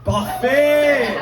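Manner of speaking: "Parfait!" sung out high and loud with a French accent; excited French exclamation. Real, not acted. To the French audience member, an excited French "Parfait!". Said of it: "Mon accent est terrible".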